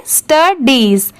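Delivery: स्टडीज drawn out slowly as "stuh-deez"; slow, falling स्टडीज for learners; model pronunciation. Shown as a demonstration. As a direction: stretch the long ee in स्टडीज. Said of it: "इसे क्या पढ़ेंगे".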